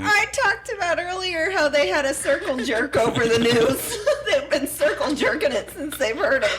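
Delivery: high-pitched, amused telling breaking into laughter; laughing while talking. Real, not acted. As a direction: tell it through real laughter. Joking about earlier circle jerk remark.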